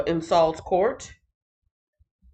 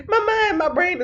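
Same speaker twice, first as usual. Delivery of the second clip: laughing; 14 semitones higher, 5 dB louder than usual. Real, not acted. Laughing at own joke.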